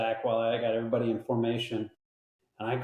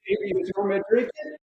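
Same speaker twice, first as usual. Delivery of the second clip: put-on incredulous kid. Kid's disbelief, voiced high.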